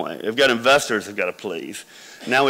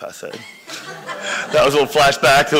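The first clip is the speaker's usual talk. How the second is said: ordinary talk, nothing marked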